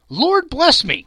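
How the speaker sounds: breathless high startled cry; cried out. Acted. Gentleman gasping in shock at Scrooge's gift, high and loud. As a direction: gasp it as if struck. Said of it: "cried the gentleman".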